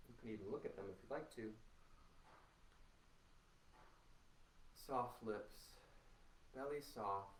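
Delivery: soft, unhurried meditation guide; guided meditation. Acted. Teacher guiding students through a body scan, soft and calm. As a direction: ease the class gently into their bodies.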